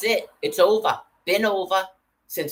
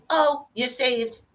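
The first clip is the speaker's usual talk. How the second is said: higher, slow, sing-song mockery; mock a smug believer, high and slow; mocking imitation; 7 semitones higher, slower than usual. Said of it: "and then".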